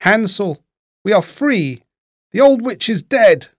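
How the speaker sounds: high, excited news of freedom; joyful cry. A narrator's reading. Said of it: "opened the stable door and cried".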